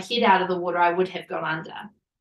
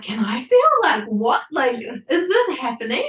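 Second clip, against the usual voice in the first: louder, eager quoting of husband; excited husband; 5 semitones higher, 5 dB louder than usual. Retold or imitated. Husband asking to feel the baby's head, excited. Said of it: "my husband being like".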